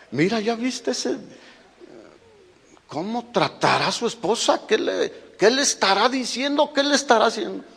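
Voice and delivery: gossiping onlookers. Retold or imitated. Onlookers wondering how he treats his wife, mock gossip.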